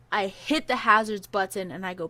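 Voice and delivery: normal talking voice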